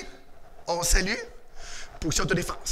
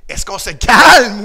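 "calme" stretched out long and loud; slow question with a big swell on "calme"; emphatic quiz question; 9 dB louder, slower than usual. Shown as a demonstration. Coach quizzing angry children, loud stretched "calme".

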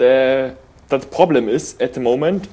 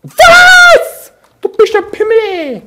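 high furious yelling; rage shout; 12 dB louder, much slower than usual. Real, not acted. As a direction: scream in disbelief at the screen. Gamer raging after dying.

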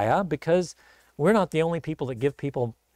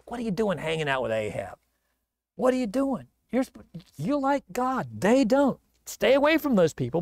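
higher-pitched acted telling-off; scolding; 4 semitones higher than usual. Prophet to Jehoshaphat after the battle, scolding. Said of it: "scolds him".

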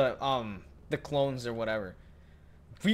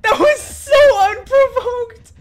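slower, higher laughing wail; laughing scream; 17 dB louder, much slower than usual. Real, not acted. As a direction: drag it out even more, laughing. Repeat, more stretched.

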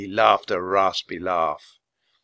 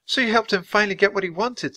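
put-on high-pitched little girl; high, earnest girl; girl's voice; 11 semitones higher than usual. A narrator's reading. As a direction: reassure your brother in a high voice. Annie's voice in dialogue.

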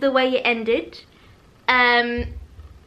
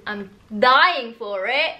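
ordinary delivery

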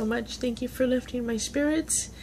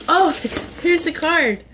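ordinary talk, nothing marked

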